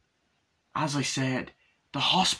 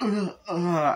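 high, louder mock groans of pain; 8 semitones higher, 5 dB louder than usual. Imitating the scene as the needle goes in, pained groan. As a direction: imitate squirming at a needle jab.

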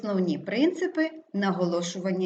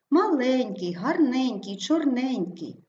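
маЛЕНЬкий, гарНЕНЬкий, чорНЕНЬкий, suffix stressed and higher; stress on ЕНЬК; 4 semitones higher, 3 dB louder, slower than usual. Shown as a demonstration. Teacher illustrating a suffix rule, stress on ЕНЬК. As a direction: lean on the middle syllable of маленький, гарненький, чорненький. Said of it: "завжди є наголошеним".

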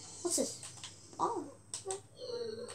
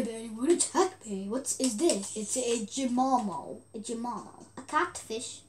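ordinary talk

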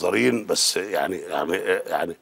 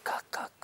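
whispered quack; 13 dB quieter, much faster than usual. Retold or imitated. Mimicking a duck's quack.

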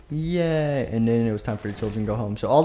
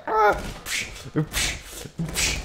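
ordinary talk, nothing marked

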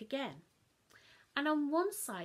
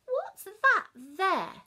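much higher, louder Mum; Mum sharp question; 9 semitones higher, 12 dB louder, much slower than usual. Acted. Mum spotting chocolate on child's chin, sharp. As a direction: pounce on the clue.

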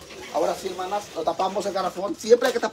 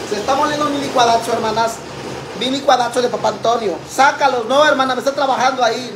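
loud over blender; 4 semitones higher, 6 dB louder than usual. Real, not acted. Talking over running blender.

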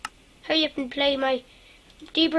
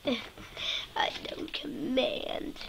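whisper; 5 semitones lower, 13 dB quieter, much slower than usual. Real, not acted. Computer broke, dismayed.

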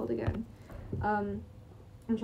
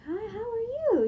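imitating a sweet woman, high and slow; 4 dB louder than usual. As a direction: put on her sweet, high greeting slowly. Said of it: "she's just being, like, so sweet".

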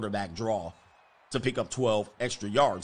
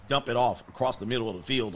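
normal talking voice

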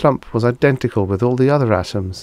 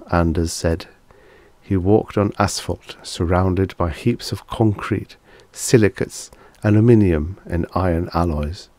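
plain reading voice

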